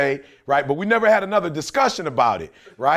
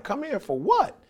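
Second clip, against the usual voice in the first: higher-pitched, puzzled question; retold baffled reply; puzzled comeback; 6 semitones higher, 6 dB quieter than usual. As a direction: balk at being summoned, pitch jumping up. Puzzled by a sudden summons.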